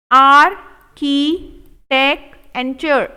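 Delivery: architecture stretched very slow, each syllable held; slow drawn-out model. Shown as a demonstration. Teacher modelling architecture slowly for students. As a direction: draw each sound out. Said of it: "teacher drills slow model take".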